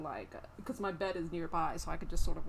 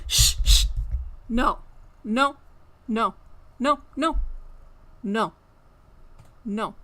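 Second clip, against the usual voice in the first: sharp, louder repeated no; loud scolding; 3 semitones higher, 11 dB louder, much slower than usual. Real, not acted. Streamer scolding her cat over and over, loud and sharp. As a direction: keep shutting the cat down.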